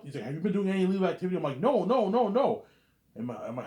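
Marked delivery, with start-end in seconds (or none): 0.2-1.4 rapid, higher and louder, pressing the question; urgent fast questioning. Retold or imitated. Messiah pressing the narrator with a fast, loud question. Agent questioning him, retold. 1.6-2.6 much higher and louder, repeated no's; panicked denial. Retold or imitated. Narrator denying illegal activity in a high loud panic.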